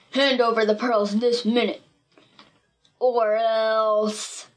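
bully's ultimatum in a put-on voice; demand, then let the threat hang; menacing villain. A narrator's reading. Villain threatens girl over pearls.

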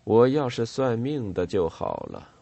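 plain reading voice

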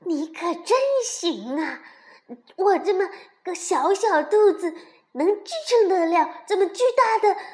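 loud, high indignant outburst; furious. A narrator's reading. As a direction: rant back, fuming and loud. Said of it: "蹦蹦气坏了". (气坏了，大声又尖地反驳)

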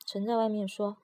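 usual speaking voice

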